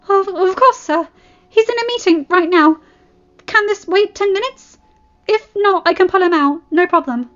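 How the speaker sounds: flustered, high and loud receptionist; nervous stutter. A narrator's reading. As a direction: stammer eagerly to please a celebrity. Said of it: "She stuttered nervously".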